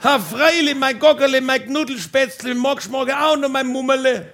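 high-pitched string of endearments; mock calling back. Wife answering husband's pet names, mock calling back. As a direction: call back sweet nothings, held up high. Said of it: "haben wir uns Kosenamen zugeschrien".

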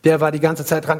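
usual speaking voice

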